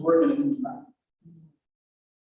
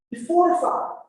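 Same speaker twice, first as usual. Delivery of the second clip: exhortation; 11 semitones higher than usual. Acted. Preacher exhorts congregation.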